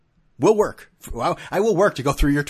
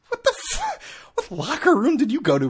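incredulous; 14 semitones higher, 4 dB louder than usual. Real, not acted. Disbelief at locker room claim.

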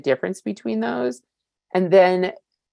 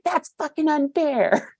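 put-on much higher, slow sulky teen voice; whiny teenager; 11 semitones higher, slower than usual. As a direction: complain like an outraged teenager. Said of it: "My teenager is the one that's like".

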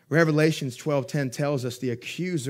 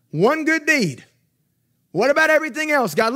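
preaching shout; 11 semitones higher, 7 dB louder than usual. Real, not acted. Preacher exhorting the congregation.

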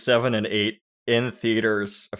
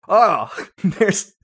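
mock pompous senator; 6 semitones higher, 4 dB louder than usual. Mocking prequel dialogue.

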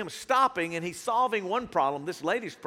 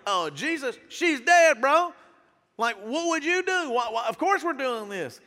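higher, mock-incredulous objection; acted-out exasperated mourner; indignant protest; 5 semitones higher, 3 dB louder than usual. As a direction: protest in disbelief, pitched up. Mourners protesting to Jesus in story.

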